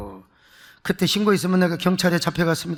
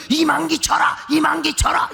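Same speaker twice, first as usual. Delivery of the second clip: higher, raised cheering chant; mock shouting cheer; 6 semitones higher than usual. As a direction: cheer on the wrestler like a rowdy fan. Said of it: "막 소리를 더 지르는 거예요". (흉내 낸 높은 응원 구호)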